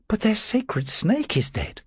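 high-pitched woman's objection; Julia. A narrator's reading. Julia objects to Caesar's remedy, high slow voice. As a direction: protest slowly in a high voice. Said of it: "Julia said".

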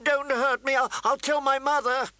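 high, frightened plea with a stumble; scared whimper. Acted. Prince pleading with Arya standing over him, high and scared. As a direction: beg and threaten like a scared boy. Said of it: "a scared, whimpery sound".

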